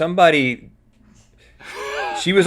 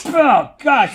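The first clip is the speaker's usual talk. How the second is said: surprised; 13 semitones higher, 4 dB louder than usual. Real, not acted. Reacting to fake food reveal.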